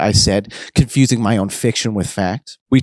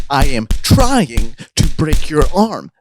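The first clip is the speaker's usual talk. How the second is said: slow, laboured words, raised pitch; straining with effort; 5 semitones higher, slower than usual. Acted. Best fails to break Damien's arm, straining. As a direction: grunt it out while heaving.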